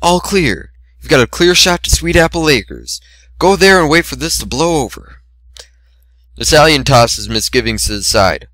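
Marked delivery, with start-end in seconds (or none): 0.0-5.2 higher brash mare voice, hushed urgency; lightly voiced tomboy mare; Rainbow Dash voice. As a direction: steer him out like a scrappy tomboy.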